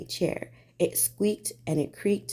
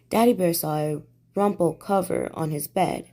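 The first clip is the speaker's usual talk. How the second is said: usual speaking voice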